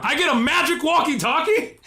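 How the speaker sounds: high, loud disbelieving question; you can't believe this is the gift; mock indignant outburst; incredulous.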